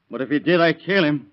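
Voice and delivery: loud, rising threat; a hot burst of menace; angry threat. Acted. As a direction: flare up and threaten to kill. Husband threatening a rival.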